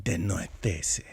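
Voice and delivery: soft, slow mock Spanish "De noitese". Mocking cohost's Spanish-sounding pronunciation, soft and slow. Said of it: "if that was Spanish".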